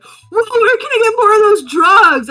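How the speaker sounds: high, loud hammed-up enthusiasm; loud, thrilled party-guy exclamation; Paul Walker. Paul Walker bursts out excited.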